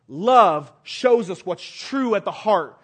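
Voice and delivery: slow, deliberate, weighty delivery; slow emphasis. Acted. Preacher stressing love's meaning, slow and deliberate. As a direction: lay each word down slowly. Preacher slows for key line.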